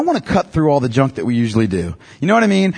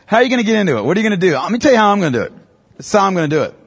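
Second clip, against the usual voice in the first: ordinary talk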